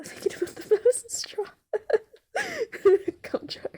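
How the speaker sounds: helpless laughing fit through the words; cracking up. Real, not acted. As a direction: break into giggles at your own gross joke. Cracks up at own joke.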